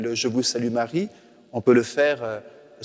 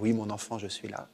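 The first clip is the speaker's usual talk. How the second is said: soft and tender, fading to almost nothing; tender soft reply; 3 semitones lower, 11 dB quieter than usual. Pretend. Priest voices Mary answering her child, soft and tender. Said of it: "Je laisse Marie me dire".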